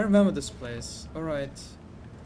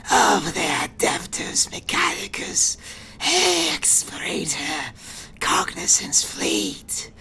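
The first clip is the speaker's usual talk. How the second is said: slow, whispery mechanical hiss; much slower than usual. Acted. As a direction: hiss each word slowly like a machine priest. Keeps the tech priest voice.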